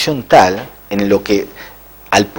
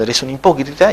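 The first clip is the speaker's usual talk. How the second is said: usual speaking voice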